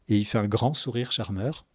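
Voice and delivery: normal talking voice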